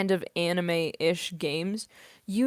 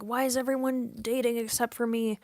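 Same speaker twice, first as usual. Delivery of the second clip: mildly whiny higher-pitched complaint; retold younger self; 5 semitones higher than usual. Streamer recalling being younger and left out, mock complaint. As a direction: quote a younger self, pitched up and drawn along. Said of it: "I was like".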